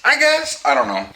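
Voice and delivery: ordinary talk, nothing marked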